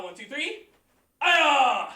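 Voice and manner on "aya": sharp, loud martial arts shout; loud kiai. Shown as a demonstration. Instructor leads the class through the form: loud kiai. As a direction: snap the shout out with the punch. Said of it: "you key up".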